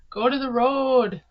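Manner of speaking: hammed-up Minnesota accent on the vowels; playful fake Minnesotan. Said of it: "do a Minnesota accent".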